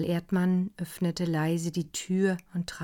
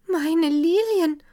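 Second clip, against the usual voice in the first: lamenting; 11 semitones higher, 8 dB louder, slower than usual. A narrator's reading. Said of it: "klagte Frau von Wallbaum leise".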